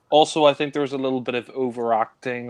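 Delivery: ordinary talk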